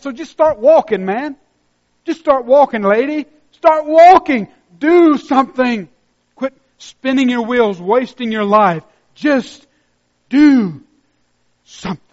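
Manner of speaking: loud, high preacher's urging; fiery exhortation. Real, not acted. Pastor urging the congregation to start walking, fiery. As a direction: drive them to start walking.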